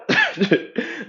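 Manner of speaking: high-pitched mocking laugh. Family now claiming they knew it, with a mocking laugh. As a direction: let out a shrill fake family laugh.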